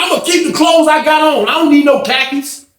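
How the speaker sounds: high, loud, indignant shout; snapping at her. Retold or imitated. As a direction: bark back the refusal like a stubborn inmate. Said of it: "Smitty snap on the lady".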